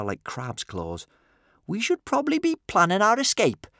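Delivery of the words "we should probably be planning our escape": very high, cheery little chirp; chirpy. A narrator's reading. Kamikaze to fellow captives planning escape, high cheery chirp. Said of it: "chirped Kamikaze".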